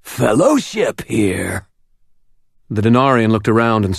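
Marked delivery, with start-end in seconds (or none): 0.0-1.7 slow, hissing snake voice; hissed. A narrator's reading. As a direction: hiss the words like a serpent. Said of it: "the word a hiss".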